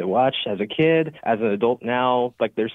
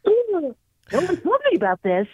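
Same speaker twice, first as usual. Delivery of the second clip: SpongeBob voice. Pretend. SpongeBob actor in character.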